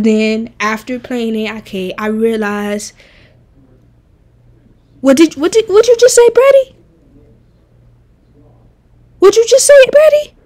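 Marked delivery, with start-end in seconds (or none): 5.0-10.4 squeaky, much higher mock disbelief, louder; mock outrage. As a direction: gasp out the question like you can't believe it. Mock outrage at chat comment.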